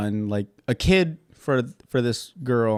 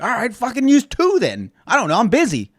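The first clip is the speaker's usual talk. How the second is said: irritated brush-off; 10 semitones higher, 5 dB louder than usual. Retold or imitated. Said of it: "they're just like".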